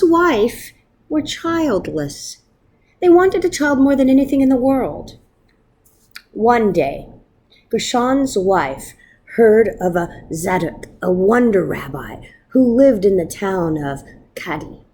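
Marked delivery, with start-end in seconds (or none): none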